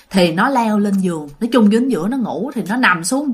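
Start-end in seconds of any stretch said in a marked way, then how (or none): none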